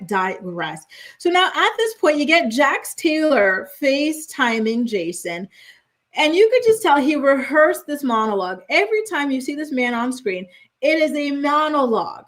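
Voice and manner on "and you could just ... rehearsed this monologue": ordinary delivery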